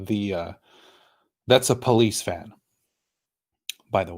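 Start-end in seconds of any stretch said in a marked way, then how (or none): none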